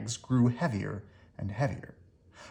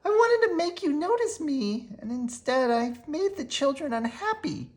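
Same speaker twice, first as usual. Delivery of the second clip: high small voice, sorry and tearful; remorseful little witch; 13 semitones higher than usual. A narrator's reading. Maggie confessing to Mama, tearful and sorry. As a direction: confess it like a sad child. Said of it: "said Maggie".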